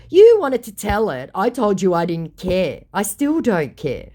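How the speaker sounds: indignant comeback; retold angry reply. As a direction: snap back at the scammer. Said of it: "I said".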